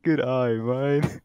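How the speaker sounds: drawn-out Aussie "Good eye, mate." stretched slowly; slow mock Australian. Trolling a friend with a slow, teasing Australian accent. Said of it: "when you say it like that".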